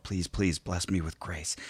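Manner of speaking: soft, under-the-breath imitation of praying; muttered prayer. Woman praying to herself as the group listens in, muttered. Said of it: "she's just kinda muttering to herself".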